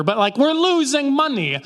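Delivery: whiny merchant. Retold or imitated. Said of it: "oh, oh yeah".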